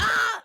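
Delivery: high loud mock yell; mock angry scream. Kid raging over dessert, loud mock yell. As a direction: let out a hammed-up cry of rage. Acted kid's outburst.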